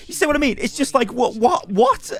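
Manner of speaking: high-pitched, baffled disbelief; incredulous. Real, not acted. YouTuber reacting to forum insults about himself, high and incredulous. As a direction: repeat it in squeaky bewilderment.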